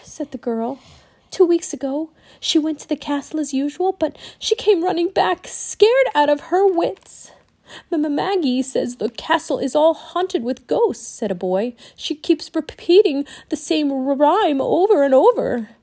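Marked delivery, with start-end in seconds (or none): 1.3-7.3 put-on high child voice, building; anxious village girl. A narrator's reading. Village girl tells frightening news. 7.9-11.1 put-on high, nervous boy voice; stammering boy. A narrator's reading. Stammering boy tells of ghosts. 11.9-15.7 put-on high, nervous boy, growing louder; stammering boy. A narrator's reading. Stammering boy continues ghost report.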